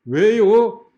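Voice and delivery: high, loud cheeky comeback; mock teenager's stubborn challenge; defiant retort. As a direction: fling it back insolently. Student repeats defiant retort louder.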